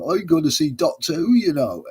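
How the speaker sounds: high, loud put-on Somerset voice; attempted Somerset accent. In-character passenger telling the taxi driver, mock Somerset accent. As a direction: play the naive Somerset passenger. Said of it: "Somerset accent, I think it was".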